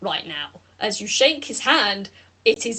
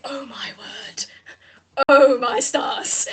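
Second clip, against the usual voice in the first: awed character; 3 dB louder, slower than usual. Acted. GM voices awed NPC.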